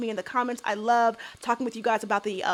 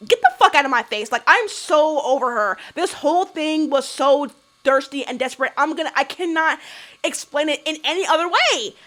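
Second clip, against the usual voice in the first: usual speaking voice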